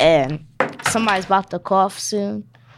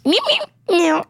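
high meowing like a cat; cat meows; 11 semitones higher than usual. Pretend. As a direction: meow like a kitten. Making cat noises.

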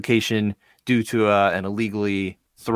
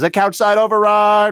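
mock announcement; 9 semitones higher, 8 dB louder than usual. Podcast host declaring a couch side override, mock announcement.

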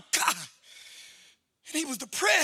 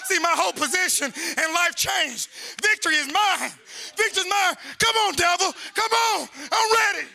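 rising, defiant preacher's call; full-force preaching; 5 semitones higher than usual. Real, not acted. Preacher daring the devil to come on, full-force preaching. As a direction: build to a high, shouted challenge.

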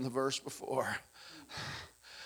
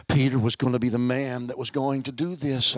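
ordinary talk

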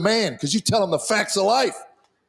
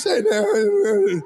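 put-on a touch higher boyish voice, lightly done; voice never dropped; 3 semitones higher than usual. Teen son greets dad in a lightly raised boyish voice. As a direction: lift it a little like a boy's unbroken voice. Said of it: "This man-child's voice never dropped".

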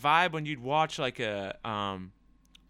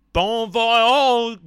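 hammed-up French, much higher and louder; over-the-top French bon voyage; mock French accent; 8 semitones higher, 13 dB louder than usual. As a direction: belt out a fake French farewell. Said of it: "Us French Americans".

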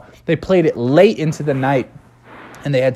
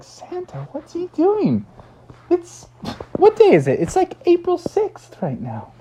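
very high, baffled questions; confused high voices. Acted. The crowd puzzled about Santa, very high baffled voice.

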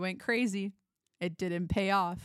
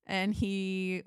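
ordinary delivery

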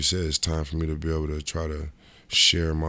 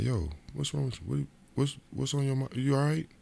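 higher, concerned checking in; worried agent; 9 semitones higher than usual. Retold or imitated. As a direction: ask what's wrong, alarmed. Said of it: "He's like".

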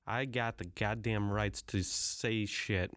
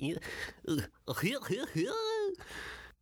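high squealing laugh. Acted. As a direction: laugh breaking high at a bad Southern accent. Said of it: "that's probably wrong too".

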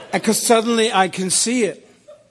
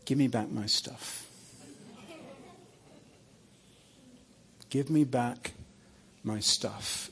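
much softer and lower, almost tender; gentle soft request; 5 semitones lower, 11 dB quieter than usual. Retold or imitated. Jesus gently asking Graham for his burdens, soft low request. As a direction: ask it kindly, near a hush. Repeating demand, now gently.

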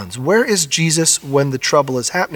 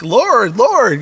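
high, louder cry of Lord, imitating frightened disciples; panicked plea; 8 semitones higher, 3 dB louder than usual. Pastor voicing the frightened disciples to the Lord, panicked plea. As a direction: plead like men in a sinking boat. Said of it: "they come like".

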